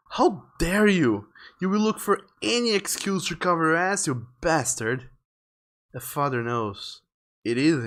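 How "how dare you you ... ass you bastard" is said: outraged. Acted. Wife to husband who doubts it's their son, outraged. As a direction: lean hard on each accusing word. Acted quarrel between the parents.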